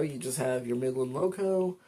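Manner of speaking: normal talking voice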